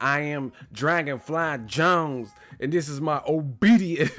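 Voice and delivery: slow, loud grand announcement; imitating Dragonfly Jones. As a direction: announce yourself like a pompous master. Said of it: "He was like".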